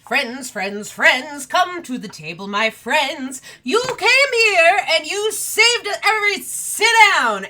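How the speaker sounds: high and loud, rising into a cry; manic host. Acted. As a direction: welcome them with wild delight. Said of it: "and goes".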